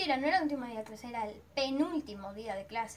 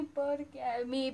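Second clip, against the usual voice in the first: slow, sinking, softer tearful repeat; imitating crying; 4 dB quieter, much slower than usual. Repeating her lament to her friend, fading crying. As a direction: let the sob fade as it drags. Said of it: "Me largué a llorar a mocote".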